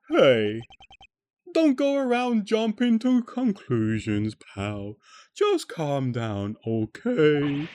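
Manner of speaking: drawn-out, swooping detective voice; detective character. Acted. Detective telling an accuser to calm down, slow character voice.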